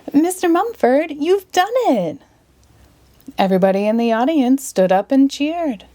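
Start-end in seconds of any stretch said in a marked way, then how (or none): 0.1-2.2 delighted. Acted. Ginger cheers the magician's success.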